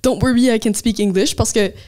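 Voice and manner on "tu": ordinary talk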